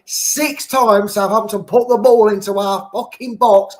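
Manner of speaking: ordinary delivery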